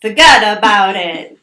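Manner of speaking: loud, hammed-up Italian-American accent. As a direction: belt it out with some Italian on it. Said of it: "got it in your own way".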